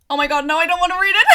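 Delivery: can't read it for laughing. Real, not acted. Reviewer cringing at the trial scene, laughing hard.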